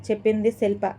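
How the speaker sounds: ordinary delivery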